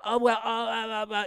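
imitated flustered fumbling for an excuse; mock stammering. Host mimicking colleagues who missed the game, flustered. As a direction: stammer as if caught out.

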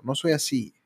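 ordinary delivery

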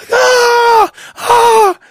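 high, loud shrieks of alarm; retold panicked scream. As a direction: shriek like a frightened mother. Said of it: "So my mom's screaming".

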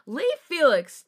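loud, high, drawn-out call; almost annoyed call. A narrator's reading. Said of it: "called out in an almost annoyed tone".

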